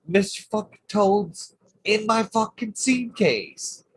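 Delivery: high, slow and disbelieving; can't believe where the toad is; outraged disbelief. Real, not acted. Telling how the toad got into his seat case, high and outraged.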